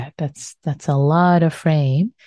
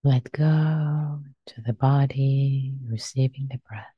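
guided meditation. Acted. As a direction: guide them gently into stillness.

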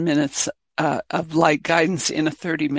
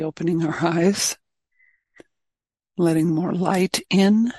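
guided meditation. Real, not acted. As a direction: guide the group gently inward, leaving space between phrases.

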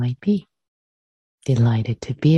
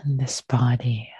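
guided meditation. Acted. Teacher leading students to arrive and release, guided meditation. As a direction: guide them gently into the body, unhurried.

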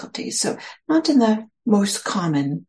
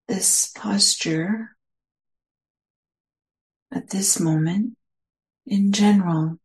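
guided meditation. Acted. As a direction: ease listeners into their posture, unhurried.

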